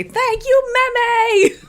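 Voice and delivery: high imitation of a kid shouting thanks; child's happy yell. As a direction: squeal it like a happy little boy. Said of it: "He yelled it".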